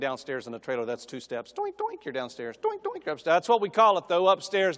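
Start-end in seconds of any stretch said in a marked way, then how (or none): 1.5-2.0 high playful doink doink; doink sound effect. Retold or imitated. Preacher mimicking two steps down, high doink. Mock sound of stepping down stairs. 2.6-3.4 high playful doink doink; doink sound effect. Retold or imitated. Preacher mimicking two steps down, high doink. Mock sound of stepping up stairs.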